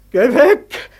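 lauter, höher, in Panik wegschreien; panischer Ruf. Acted. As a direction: den Tod verzweifelt fortjagen. Tor wehrt den Tod ab. (laut und panisch: Geh weg)

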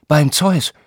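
beim Zeus gasped high in mock fright; a scared oath at the monster; frightened oath. Herr August plays the god seeing a beast, frightened oath. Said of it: "Sein Mund verzog sich voller Schrecken".

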